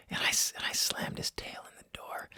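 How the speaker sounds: unvoiced, ashamed confession; guilty whisper. Real, not acted. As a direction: whisper it like you can't bear to admit it. Confessing hurting his dog.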